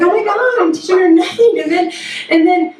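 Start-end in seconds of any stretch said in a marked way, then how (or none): 0.0-1.6 a touch of panicked self-blame; mock despair. Mother realizing her failure, lightly panicked. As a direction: wail lightly at your own parenting. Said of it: "I'm like".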